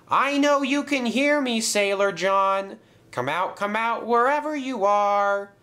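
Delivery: high, sing-out shout into the dark; calling out. A narrator's reading. James calling Sailor John out of hiding, high shout. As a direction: call high to someone hiding. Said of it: "he shouted loudly".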